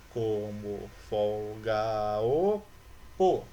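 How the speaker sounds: "Como folga o po" stretched out slowly, syllable by syllable; syllable counting. Shown as a demonstration. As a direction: drag out each syllable of "Como folga o po", running the vowels together. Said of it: "lembra que as vogais, elas se unem". (separando devagar as sílabas de "Como folga o po")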